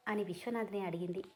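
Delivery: usual speaking voice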